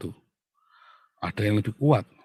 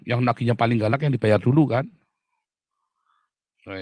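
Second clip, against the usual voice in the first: ordinary talk, nothing marked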